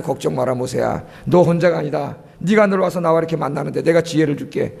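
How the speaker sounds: a lightly voiced, calm divine father; voicing God. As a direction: speak as God reassuring a weary leader. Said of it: "그때 하나님".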